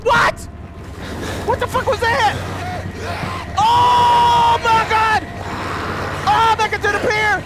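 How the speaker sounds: high, loud panicked yells; terrified shouting. Real, not acted. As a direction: scream in real fright as they chase you. Chased by monsters in horror game.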